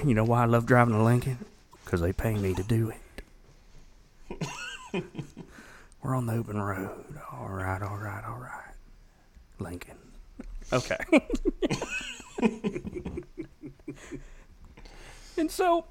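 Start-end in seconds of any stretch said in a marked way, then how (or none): 0.1-10.0 hushed lazy celebrity car commercial; Matthew McConaughey. Pretend. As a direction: lean back and murmur like a movie star in a car ad. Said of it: "Matthew McConaughey rolling his burger".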